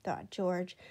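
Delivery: ordinary delivery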